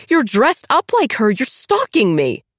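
high, loud accusation; angry accusing. Acted. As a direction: hurl the accusation at her. Accusing Diana on the street.

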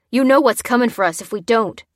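loud, insistent warning; urgent warning. A narrator's reading. Bearded man to the lieutenant, urgent. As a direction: press the point forcefully.

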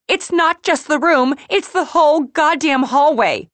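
high, loud, exasperated outburst; yelling complaint. A narrator's reading. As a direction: shout down the apologetic hotel worker. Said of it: "Some woman is yelling at Patsy".

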